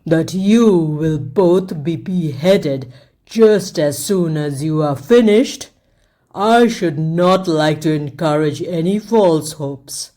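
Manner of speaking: a cruel king savouring his threat, slowly; wicked king. A narrator's reading. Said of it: "the king".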